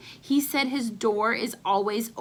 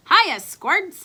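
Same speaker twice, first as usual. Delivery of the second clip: higher, louder breezy greeting; jaunty uncle; 8 semitones higher, 8 dB louder than usual. Acted. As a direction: greet the kids like a cocky uncle.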